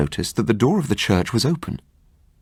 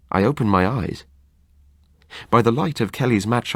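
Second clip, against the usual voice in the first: ordinary delivery, nothing marked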